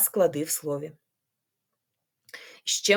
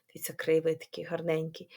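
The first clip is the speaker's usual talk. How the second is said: whisper; 3 semitones lower, 6 dB quieter than usual. Acted. Admiring the picture softly.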